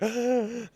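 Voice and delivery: high, sharp intake of breath; mock panicked gasp. Distraught niece before asking for a paper bag, panicked gasp.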